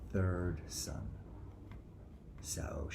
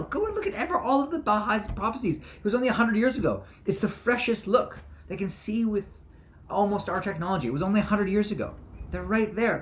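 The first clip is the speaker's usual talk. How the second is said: much higher and louder excited preaching; manic prophet; 12 dB louder than usual. Acted. Speaker preaching about Baha'i prophecies and his council, high and loud. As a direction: rant like an overexcited prophet.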